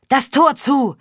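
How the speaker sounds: high loud command; shouting order. Acted. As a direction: yell the order at the gate. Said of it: "rief der Anführer des Trupps".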